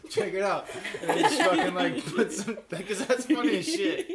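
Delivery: high, loud, laughing mock prankster; cracking up at the joke; laughing at the prank idea.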